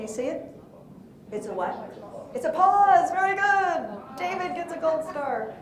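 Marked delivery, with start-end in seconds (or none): 2.3-3.1 excited. Real, not acted. Teacher praising answer. 3.1-5.5 higher, bright teacher praise; playful gold-star praise; excited praise. Real, not acted.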